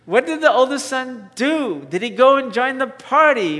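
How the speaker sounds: ordinary talk, nothing marked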